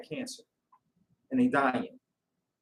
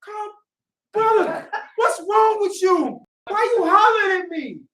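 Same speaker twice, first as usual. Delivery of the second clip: bewildered protest; 11 semitones higher, 6 dB louder than usual. Acted. Brother taken aback by a hollered greeting, bewildered protest.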